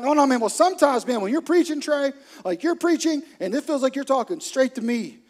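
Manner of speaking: mock-earnest gushing; gushing churchgoer. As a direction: play a worked-up fan of the preaching.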